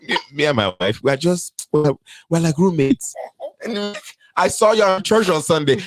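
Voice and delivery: imitated African man, pitching up insistently; African accent. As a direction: explain it away in an African accent. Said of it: "That man said".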